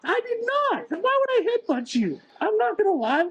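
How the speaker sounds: high, insistent indignant denial. Real, not acted. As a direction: insist you're innocent, voice up high. Denying headbutt accusation.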